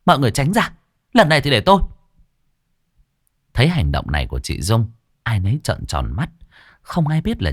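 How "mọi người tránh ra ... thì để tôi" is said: raised, commanding call; loud call. Acted. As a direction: step forward and take charge loudly. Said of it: "nói lớn".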